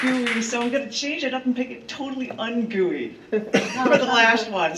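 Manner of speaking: can't stop giggling while explaining; laugh-filled aside to friends. Real, not acted. Laughing through introducing next piece.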